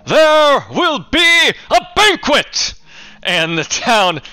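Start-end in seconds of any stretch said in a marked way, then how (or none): 0.1-2.7 high, loud, drawn-out announcement to the crowd; mayor booming out a feast to the cheering crowd; grand proclamation. Acted. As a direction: proclaim it to the whole town.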